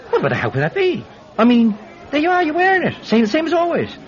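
high, hurried babbling; flustered bluster. Acted. Thief hearing of his own theft, flustered bluster. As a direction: cover up with breathless false amazement.

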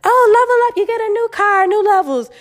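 high, loud mocking influencer voice; mocking social media. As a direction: squeal it like a hyped influencer. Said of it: "people on social media tell you".

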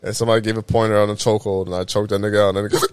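ordinary talk

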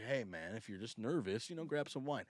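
low, soft, casual reassurance; easygoing, hushed advice; laid-back agent. Retold or imitated. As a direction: play the agent quietly talking a client down. Agent calms him before reading.

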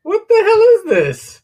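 very high, loud, slow exclaim; amazed. Real, not acted. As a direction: shriek of disbelief. Showing off weird knife.

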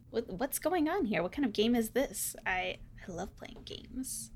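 hushed question, fading to a whisper; soft curiosity. Acted. Visitor approaches the carnival game, softly curious, fading to whisper. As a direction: ask softly, fade away at the end.

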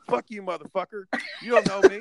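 shouted insult, pitch jumping up and loud; retold drunk yell. Drunk narrator yelling at the guy at the party.

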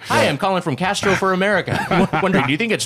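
hammed-up cold-call pitch; mock canvasser. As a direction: play a phone pollster, breaking into laughter. Mock campaign phone survey call.

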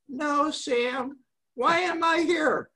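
high, slow campy retort; imitated effeminate man. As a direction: put on a camp, high-pitched reply.

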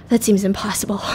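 retold in a breathy near-whisper; hushed doubt. Ashley doubts the plan to his accomplice, hushed. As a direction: whisper a doubtful reply, acting it out.

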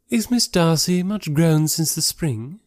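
high, slow ladylike question; inquiring society lady. Acted. Miss Bingley asking after Darcy's sister, ingratiating.

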